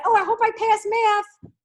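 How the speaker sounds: mock a kid hoping to pass; whiny kid voice. Kid at home whining about math, high and drawn out. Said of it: "your kids come home from school and say".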